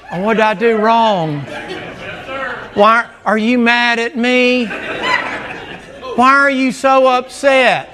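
put-on whiny innocent child, slow and high; innocent kid. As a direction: play the baffled kid who thinks he did nothing. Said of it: "they just stand there like".

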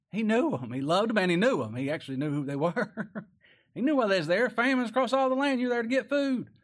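loud, high preached scripture; full-voiced pulpit reading; preaching at full force. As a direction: proclaim it to the back pews. Preacher rising to a shout.

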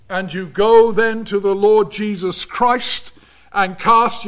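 measured pulpit preaching, rising on key words. Acted. Preacher warning the congregation about unbelief, earnest sermon delivery.

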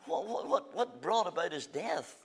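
ordinary talk

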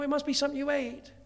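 hammed-up clueless husband; laughing mock-dismissive husband. Preacher imitating his younger self shrugging off his wife, laughing. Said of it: "I go".

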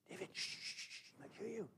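urgent whispered shushing; hissed, soft warning to keep quiet; alarmed hushing. Acted. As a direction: hush him before he's overheard. Soldiers hush David near Goliath.

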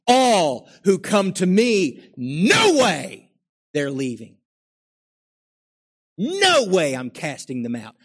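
loud, high and slow, voice stretched; forceful proclamation. Acted. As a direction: thunder out the promise. Voicing Jesus' promise forcefully.

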